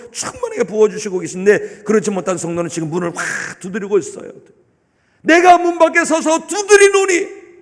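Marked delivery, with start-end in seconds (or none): 5.2-7.6 proclaimed scripture. Acted. Preacher quoting scripture.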